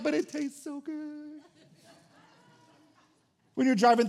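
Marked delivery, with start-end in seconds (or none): 0.0-1.4 soft, drawn-out mock whine; whiny protest. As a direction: plead weakly for a guilty treat. Pleading with God over food.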